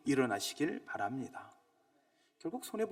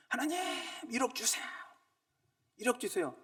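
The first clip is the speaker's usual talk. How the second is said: higher, wheedling petition; begging God for a hundred million won; mock pleading prayer; 10 semitones higher than usual. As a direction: play a greedy believer begging God. Mock prayer of a greedy man.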